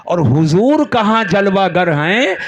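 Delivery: rhetorical build to a ringing finish; fervent preaching. Real, not acted. Preacher contrasts follower and Prophet for the congregation, rising oratory. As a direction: pose the contrast, then drive it home to the crowd.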